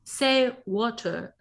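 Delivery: attempted British accent, slow and drawn out. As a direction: say WATER slowly in a shaky attempt at British, dropping the R. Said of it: "I can't do a British accent".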